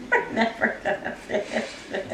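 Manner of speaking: soft breathy laughing; laughing through words. Real, not acted. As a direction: laugh it out quietly. Laughing aside.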